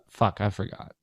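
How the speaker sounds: ordinary talk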